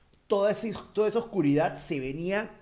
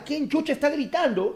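bewildered question; 10 semitones higher, 3 dB louder than usual. Retold or imitated. Acting out bewildered waking thought.

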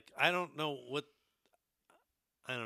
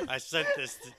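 ordinary talk, nothing marked

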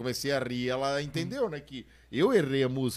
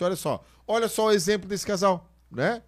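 acted preacher address; 5 semitones higher, 4 dB louder than usual. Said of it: "parei na frente desse casal e disse".

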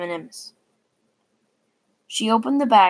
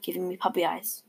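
ordinary delivery, nothing marked

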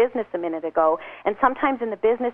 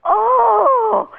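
high, louder melting oh of delight; delighted gasp; 13 semitones higher, 8 dB louder than usual. Retold or imitated. A bragger touched by a compliment, delighted high gasp. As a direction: let the pride melt into a gasp. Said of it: "he melted before my eyes".

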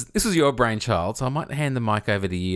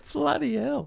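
surprised; 3 dB quieter, slower than usual. Real, not acted. Costs of worldwide mug shipping.

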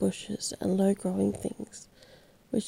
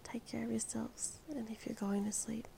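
soft whisper; 13 dB quieter than usual. Real, not acted. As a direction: whisper a gentle goodnight to sleepy listeners.

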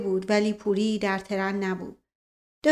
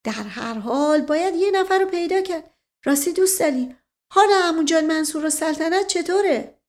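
raised, playful aristocrat; teasing gentleman, lightly higher; sly nobleman voice; 6 semitones higher, 5 dB louder than usual. Acted. Asadollah Mirza voiced in the novel. (صدای اشرافی شوخ)

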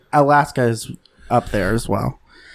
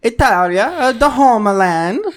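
mock Italian accent; 9 semitones higher than usual. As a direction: draw it out in a hammed-up Italian accent. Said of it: "Can I make fun of Italian people?".

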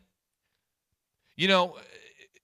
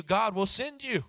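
normal talking voice

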